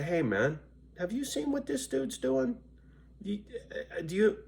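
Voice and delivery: high, strained voice of a buddy calling in; mock caller voice. Voicing a fan calling a friend, pitched high and odd.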